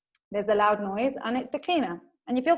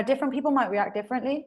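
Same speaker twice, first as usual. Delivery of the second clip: ordinary talk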